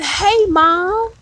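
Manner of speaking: high, bright-eyed surprise at seeing mom; joyful greeting. Retold or imitated. Greeting his late mother in a dream, high and joyful. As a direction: call out to mom in pleased disbelief.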